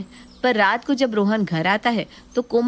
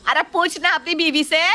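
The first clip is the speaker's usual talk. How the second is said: higher, sharp mother-in-law jab; huffy retort; 8 semitones higher than usual. Acted. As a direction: toss it over your shoulder in a huff. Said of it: "गुस्से में वहाँ से चली जाती है".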